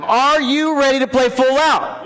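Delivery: high, drawn-out rallying cry; powerful, enthusiastic call. Shown as a demonstration. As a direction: rouse the whole room, stretching the words. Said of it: "loud, enthusiastic yes".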